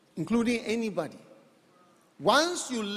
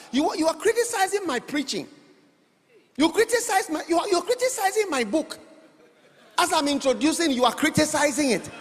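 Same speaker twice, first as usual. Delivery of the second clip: higher and louder teasing scold, laughing; playful mock rebuke to the congregation; mock indignation; 7 semitones higher, 4 dB louder than usual. Real, not acted. Preacher mock-scolds critics, laughing.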